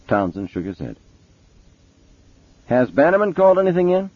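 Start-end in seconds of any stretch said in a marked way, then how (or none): 2.7-4.1 high, pressing cop voice; detective's demand. A narrator's reading. Mason checking on Bannerman with Townsend, high and pressing. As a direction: fire the question off high.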